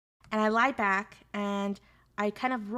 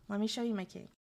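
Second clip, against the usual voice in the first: teasing hush; 6 dB quieter than usual. Shown as a demonstration. Streamer teasing chat about her kink, hushed repeat. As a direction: drop it down as a teasing tease. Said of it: "Yeah, let me show you my kink".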